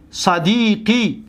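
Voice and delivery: صدیقی drawn out very slowly, each syllable apart; slow demonstration. Shown as a demonstration. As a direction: spell صدیقی out slowly for the class. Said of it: "دقت بتید". (صدیقی را خیلی آهسته و هجا به هجا بگو)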